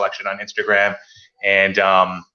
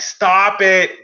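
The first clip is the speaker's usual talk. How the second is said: higher, louder mock scold; 9 semitones higher, 4 dB louder than usual. Scolding the lazy shop owner, mock loud stop. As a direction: snap it like scolding a kid.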